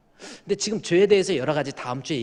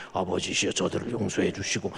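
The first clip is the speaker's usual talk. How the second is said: lightly lowered solemn prayer; deep prayer voice; 6 semitones lower than usual. Retold or imitated. Pastor teasing the elder's low prayer, deep solemn. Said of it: "목소리를 왜 이렇게 깔아요?".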